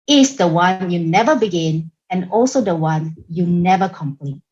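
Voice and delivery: ordinary delivery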